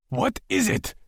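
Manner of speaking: high, sharp question shouted. A narrator's reading. Lex shouting at Asher, a high sudden shout. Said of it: "I shouted".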